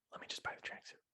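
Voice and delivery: hushed, voiceless aside to oneself; resigned whisper. Acted. Shopper settling on a tracksuit, resigned whisper. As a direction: whisper it as if giving in. Giving in after trying things on.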